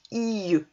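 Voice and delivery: "I E" as one sound, long i sliding into eu; drawn-out I. Shown as a demonstration. Teacher blending I with E U, i held long. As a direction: stretch the I, glide into E as eu. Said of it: "E U, ça fait E".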